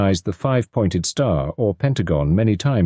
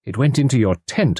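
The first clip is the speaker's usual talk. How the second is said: ordinary delivery, nothing marked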